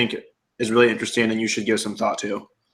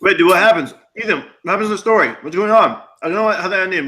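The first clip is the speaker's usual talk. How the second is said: excited; 8 semitones higher, 4 dB louder, faster than usual. Real, not acted. Story suddenly cut off mid-sentence.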